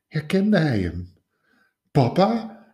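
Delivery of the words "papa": high, tentative question; hesitant recognition. A narrator's reading. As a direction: ask it unsure, voice lifting. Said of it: "zei hij schoorvoetend".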